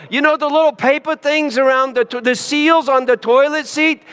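ordinary talk

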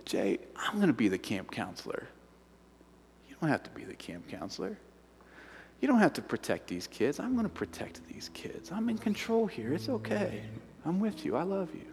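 soft, hushed reassuring whisper; gentle whisper. Retold or imitated. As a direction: whisper like a comforting voice in the heart. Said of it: "whisper to you audibly".

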